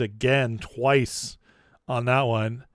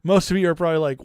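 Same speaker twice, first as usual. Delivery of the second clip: ordinary talk, nothing marked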